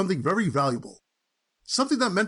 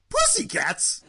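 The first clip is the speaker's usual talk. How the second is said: ordinary talk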